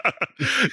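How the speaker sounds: sudden high laugh; bursting out laughing; high burst of laughter. Real, not acted. Host cracks up.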